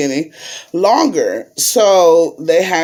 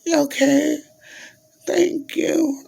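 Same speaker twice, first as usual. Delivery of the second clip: high, faint, strained little voice of someone too sick to talk; weak sick voice; 12 semitones higher, 4 dB quieter, slower than usual. Shown as a demonstration. Showing viewers how weak her voice was in the hospital, faint and high. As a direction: squeeze out a weak, high sickbed voice. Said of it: "I couldn't even talk like this".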